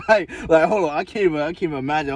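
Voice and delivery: high laughing disbelief; cracking up, squeaky and loud. Real, not acted. Laughs while telling friends he can't even imagine it. Laughing at his own idea.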